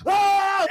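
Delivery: high, loud hype yell. Retold or imitated. Hyping the locker room before a game, yelling. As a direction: roar like a team getting hyped.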